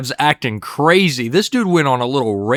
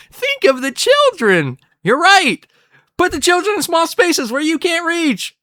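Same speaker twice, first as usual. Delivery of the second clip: much higher, strained falsetto; squeaky cartoon voice. Cartoon characters panicking about children, high squeaky mock voice. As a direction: squeeze it up into a tiny cartoon squeak.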